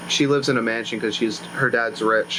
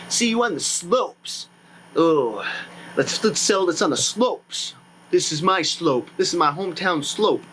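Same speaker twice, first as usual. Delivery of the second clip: lightly voiced, slow, higher show-off skier; little acting; 3 semitones higher than usual. Skier boasting about his hometown slope, slow and higher. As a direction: play a cocky local bragging about his slope. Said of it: "Little acting".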